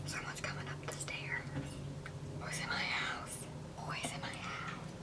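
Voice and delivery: hushed, worried whisper; alarmed whisper. Real, not acted. Hearing footsteps on the stairs, an alarmed whisper. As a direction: whisper so whoever is on the stairs won't hear.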